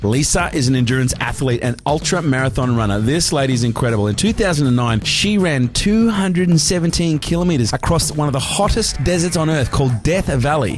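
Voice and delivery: punchy, lifted announcer delivery; podcast host introduction. Acted. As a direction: introduce the guest like a big event.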